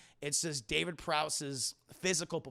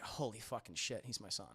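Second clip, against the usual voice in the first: stunned Vader; 8 semitones lower, 10 dB quieter than usual. Retold or imitated. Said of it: "Vader's like".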